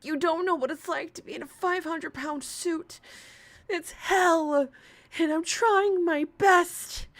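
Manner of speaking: high, loud whiny mock of her; hammed-up whining streamer; mocking impression. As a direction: play her up as a self-pitying whiner.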